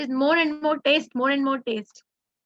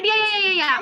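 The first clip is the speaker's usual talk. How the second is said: higher, quick run of yeahs; remembering the elephant, delighted; excited recognition; 7 semitones higher, 4 dB louder, faster than usual. Real, not acted. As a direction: burst out as the answer clicks. Host finally recognizes the elephant.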